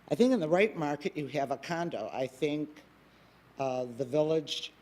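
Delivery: normal talking voice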